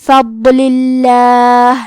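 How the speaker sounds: slow فضل اللہ with a light, thin ل in اللہ; thin lam. Shown as a demonstration. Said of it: "حرف اللام کو پتلی آواز میں پڑھیں گے".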